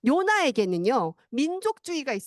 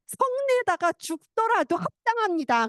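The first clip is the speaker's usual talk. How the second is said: higher, slow, defiant mock anger; stubborn angry retort; 6 semitones higher, slower than usual. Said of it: "성을 내냐".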